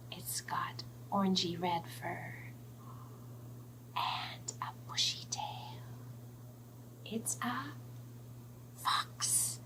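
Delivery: soft secretive whisper; whispered hint. Acted. Teller hinting the fox to kids, soft whisper.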